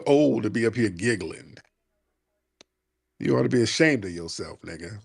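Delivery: ordinary delivery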